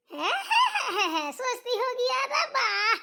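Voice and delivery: high mocking cackle, slowing down. Mother-in-law mocking the bahu's thoughts with a high cackle. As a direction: cackle at her as if she were a fool.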